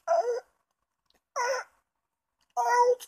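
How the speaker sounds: high, strained squeals of pain under a crushing bar; pinned-under-the-bar yelps. Retold or imitated. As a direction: squeak out each oh like the air is being pressed out. Weights slam down on him.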